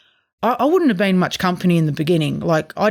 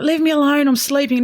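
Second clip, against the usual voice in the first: higher, whiny refusal; reluctant groan; 7 semitones higher than usual. Retold or imitated. Wife refusing husband's outing, higher whiny protest. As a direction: push him away, half-asleep and sulky.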